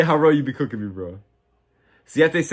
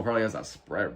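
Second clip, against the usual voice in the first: normal talking voice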